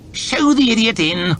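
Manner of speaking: high, disdainful lordly voice; a bored, arrogant official; haughty lord. Acted. Lord chamberlain announcing to his court, disdainful. Hoff gives an order.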